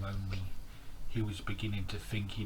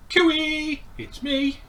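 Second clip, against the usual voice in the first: high, loud fish call to a friend; calling out as Stanley; 10 dB louder, much slower than usual. Acted. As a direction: sing it out much higher. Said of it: "he called".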